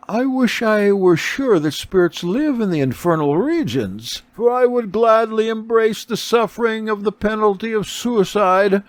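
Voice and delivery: put-on a mother longing for her lost children; grieving beggar woman. A narrator's reading. Beggar woman tells Kepha she would suffer to see her children, high and aching.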